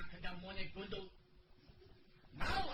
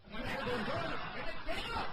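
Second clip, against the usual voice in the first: higher, louder taunting chant with a laugh; louder teasing taunt; 7 semitones higher, 5 dB louder than usual. Acted. Passenger escalates the taunt at the other passenger, louder and higher. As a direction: rub it in, louder and giggling.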